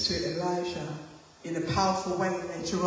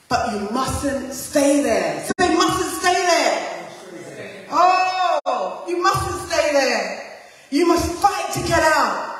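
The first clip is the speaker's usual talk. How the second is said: fervent preaching; 5 semitones higher, 5 dB louder, slower than usual. Acted. Preaching at full force.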